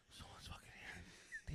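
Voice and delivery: very slow, hushed whisper; retold whisper. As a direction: breathe it out secretly.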